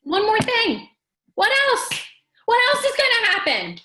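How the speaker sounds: high, loud mock exasperation, voice climbing; a hammed-up harried mom at the end of her rope; exasperated wail. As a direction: whine that nothing else could possibly go wrong. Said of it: "and you say".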